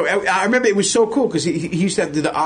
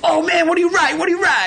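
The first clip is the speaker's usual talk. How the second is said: excited shout of an eager fan; 12 semitones higher, faster than usual. Retold or imitated. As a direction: imitate a thrilled fan, pitched way up. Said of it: "they're all, like, fans".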